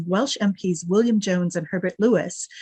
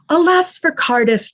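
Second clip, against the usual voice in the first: slow, high, louder mock lament; scoffing; 9 semitones higher, 6 dB louder than usual. Lecturer reading the paper's scornful jab, slow mocking lament. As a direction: scoff it like a sneering editor. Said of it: "the paper scoffed".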